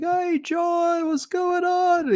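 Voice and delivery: a deliberately bad Australian accent, like a drunk mate greeting you; terrible Australian accent. Drunk Australian friends calling John on video, terrible Australian accent. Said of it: "it's a terrible Australian accent".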